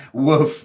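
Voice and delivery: a quick playful dog woof; mock woof. Shown as a demonstration. Teacher showing students what bark means, playful woof. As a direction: bark like a friendly dog for the class. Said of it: "To bark".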